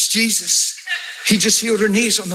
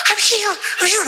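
higher, tearful "I'm healed"; crying; 9 semitones higher, faster than usual. Retold or imitated. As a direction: sob out the words "I'm healed". Woman crying, healed knees.

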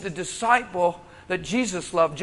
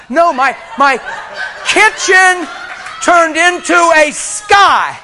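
loud, higher, laughing cry; excited shout; 8 semitones higher, 7 dB louder, much slower than usual. Real, not acted. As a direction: shout it out in awe. Preacher reliving the vision.

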